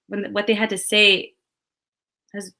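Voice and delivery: ordinary talk, nothing marked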